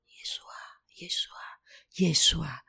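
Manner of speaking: breathy hushed calling on Jesus; barely voiced, pleading in a whisper; whispered prayer. Praying alone in his office, whispering.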